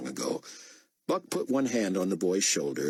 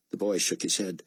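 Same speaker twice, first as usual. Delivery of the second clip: plain reading voice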